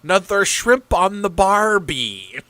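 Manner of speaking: slow, loud, hammed-up Australian accent; mock Australian accent. Streamer imitating a TV character's Australian accent, hammed-up. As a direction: ham up an Aussie accent like a sitcom character. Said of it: "tries to do an Australian accent".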